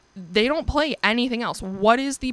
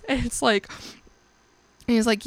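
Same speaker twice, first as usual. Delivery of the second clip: normal talking voice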